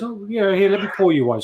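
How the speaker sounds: a hint of a pushy saleswoman pouring a drink; mock saleswoman.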